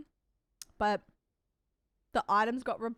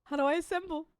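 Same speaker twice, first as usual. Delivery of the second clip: higher, mock-whiny question; silly put-on voice; 6 semitones higher, faster than usual. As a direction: repeat it in a goofy voice. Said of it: "repeated in a put-on voice".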